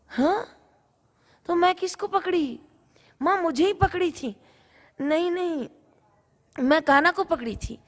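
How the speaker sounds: high, flustered denial; bewildered. Acted. Gopi refusing to believe Kanha was asleep, high and flustered.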